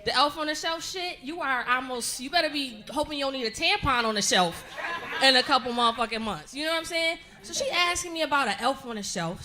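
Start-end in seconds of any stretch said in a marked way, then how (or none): none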